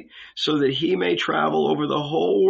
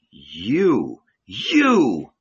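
shuddering; 3 semitones lower, 3 dB louder than usual. A narrator's reading.

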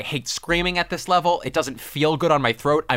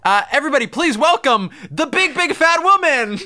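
announcer voice; 12 semitones higher, 5 dB louder than usual. Pretend. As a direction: announce her like a game show host. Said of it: "could you sample that for us".

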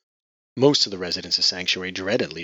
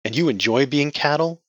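scornful; 8 semitones higher, 6 dB louder than usual. Acted. Scornful question.

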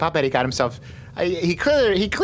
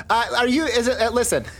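high character voice; 8 semitones higher than usual. Acted. Vern in character.

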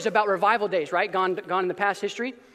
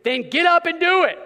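punchy command; 7 semitones higher, 5 dB louder than usual. Real, not acted. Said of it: "in Greek".

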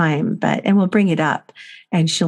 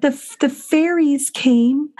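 put-on higher, slow, wide-eyed child voice; three-year-old's voice; 8 semitones higher, slower than usual. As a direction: tell it like an excited little girl. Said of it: "And she said".

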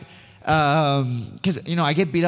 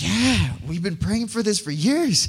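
excited; 7 semitones higher than usual. Retold or imitated. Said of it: "he's freaking out".

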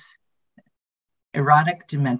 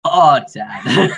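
hammed-up moan; 4 semitones higher, 9 dB louder than usual. Joking Minnesota Todd bit.

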